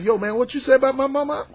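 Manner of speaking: high, hot street challenge; confronting tough guy. Retold or imitated. A guy squaring up to someone over his mama, challenging. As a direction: square up and demand an answer. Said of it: "you going up there and said".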